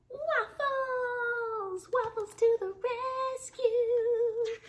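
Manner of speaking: high, loud waffle cry; excited proclamation. Acted. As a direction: cry it like a hero's rallying call. Narwhal announces favourite food.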